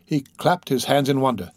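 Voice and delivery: usual speaking voice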